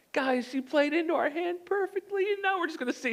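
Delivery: high, hushed scheming voice; angels' aside. Acted. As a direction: act out a high-pitched conspiratorial aside.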